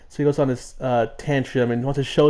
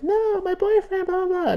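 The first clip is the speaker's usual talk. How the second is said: squeaky creature voice. Acted. Gander Junior protesting about his boyfriend, very high squeak. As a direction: squeal it out like a tiny critter. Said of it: "Gander Junior is like".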